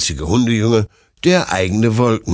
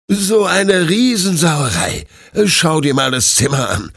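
higher, indignant owner telling off his dog; scolding; 8 semitones higher, 4 dB louder than usual. A narrator's reading. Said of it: "schimpft Detlef".

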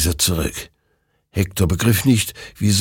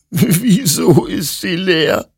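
high, slow, tearful question; slow, high sobbing disbelief; 12 semitones higher, 5 dB louder, slower than usual. A narrator's reading. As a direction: plead through sobs, slowly. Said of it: "schluchzte Hector".

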